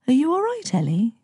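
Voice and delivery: high, sleepy tenderness; sleepy smile. A narrator's reading. Drowsy mum checking on her son, sleepy smile. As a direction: ask drowsily, voice lifted with a smile. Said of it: "she smiled sleepily".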